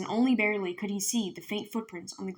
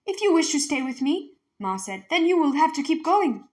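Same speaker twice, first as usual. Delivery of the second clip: angry; 7 semitones higher, 5 dB louder than usual. Acted. Ma angrily answering husband.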